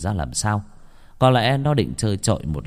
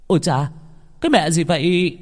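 startled cry; 8 semitones higher than usual. Acted. Boy waking confused.

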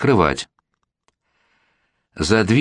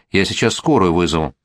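usual speaking voice

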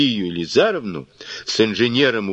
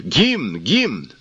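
shout; 2 semitones higher than usual. A narrator's reading. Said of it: "крикнул на берег".

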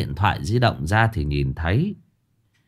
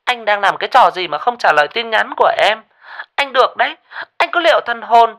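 much higher shrill threatening female voice, louder; angry girlfriend; 13 semitones higher, 6 dB louder, faster than usual. Acted. Jealous girlfriend threatening her boyfriend, angry.

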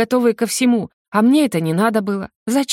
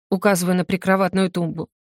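ordinary delivery

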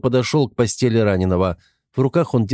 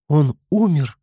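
high, startled frightened question; frightened cry; 7 semitones higher, slower than usual. A narrator's reading. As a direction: gasp it out in fear. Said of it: "испуганно воскликнула девушка".

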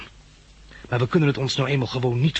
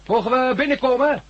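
calling out; 14 semitones higher, 4 dB louder than usual. Acted. Calling out at a guarded door.

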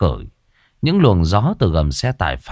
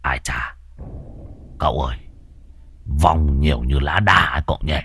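hushed, low and soft; whispered aside; 6 semitones lower, 5 dB quieter than usual. Acted. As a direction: lean in and murmur to the master. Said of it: "Môn Phọt thì thầm". (thì thầm khe khẽ)

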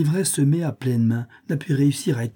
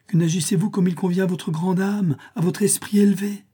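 pleading, higher and rising toward the end; 8 semitones higher than usual. A narrator's reading. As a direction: urge her on, an earnest plea. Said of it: "d'un ton suppliant". (supplication plus aiguë, qui monte)

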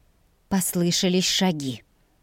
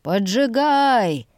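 drawn-out, higher-pitched call; narrated shout; 4 semitones higher, much slower than usual. A narrator's reading. Someone by the garden ordering the house set alight, calling out. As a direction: call the order out slowly across the yard. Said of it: "крикнул кто-то со стороны огорода".

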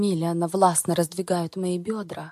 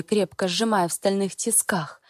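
usual speaking voice

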